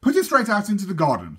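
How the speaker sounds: lightly voiced mother telling her off; mother's order.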